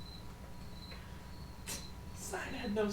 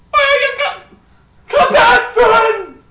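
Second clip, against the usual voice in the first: high, full-volume shouting; distant yelling; 21 dB louder, much slower than usual. Acted. Game master as a voice the players hear, high yelling. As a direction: holler after someone getting away. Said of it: "As you hear".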